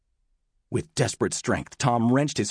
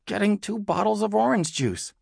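put-on high, sheepish kid voice; mumbling boy; 10 semitones higher than usual. A narrator's reading. Tom explaining himself to his sister, mildly mumbled. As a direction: answer small and high-pitched. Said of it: "Tom mumbled".

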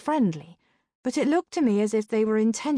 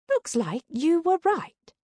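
high, slow character voice; drawn-out, high-pitched admission; Sabrina; 9 semitones higher than usual. A narrator's reading. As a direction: pitch it up and admit it slowly.

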